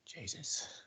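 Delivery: whispered aside, barely voiced; under his breath. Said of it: "says a lot of stuff under his breath".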